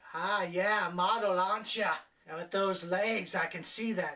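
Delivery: a hint of a sleazy driver; creepy cab driver. Driver ogling her legs, mildly mock leering. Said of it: "a creepy cab driver".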